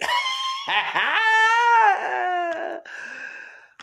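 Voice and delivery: high shrieking laugh; big laugh. Real, not acted. Host cracking up about still breathing, shrieking laugh. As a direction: howl with laughter.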